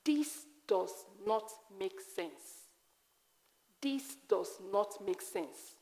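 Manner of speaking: slow, soft lament to God; a slow, hushed cry of despair; anguished cry. Retold or imitated. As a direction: plead it quietly and slowly. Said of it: "I cried".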